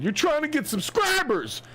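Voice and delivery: squeaky high mock of the driver; mimicking the lady. Mocking the driver's jab at the filmers, in a high squeaky voice. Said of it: "'Cause she said".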